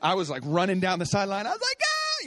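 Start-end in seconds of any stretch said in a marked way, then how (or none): 1.5-2.2 sudden very high excited yelp, "Ah!"; retold excited scream. Dad retelling his son's breakaway, yelping very high. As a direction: let out a high, freaked-out cry. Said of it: "You know, I'm freaking out".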